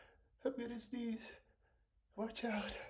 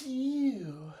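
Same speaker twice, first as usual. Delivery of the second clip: loud sneeze; 4 semitones higher, 10 dB louder than usual. Real, not acted. Sneezing on camera.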